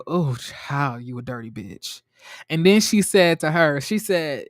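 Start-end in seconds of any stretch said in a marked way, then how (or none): 0.1-2.0 low, soft, knowing mock judgment; mock scandalized aside. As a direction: drop the voice low and softer to throw shade. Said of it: "I was like".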